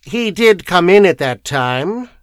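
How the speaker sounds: mildly sharp lawyer's correction; sharply. A narrator's reading. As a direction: put a firm edge on it. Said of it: "said Mister Mayherne sharply".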